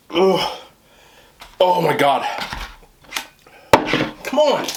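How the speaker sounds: high strained cries of pain; pained cry. Real, not acted. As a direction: cry out against the burn. Burning from spicy food.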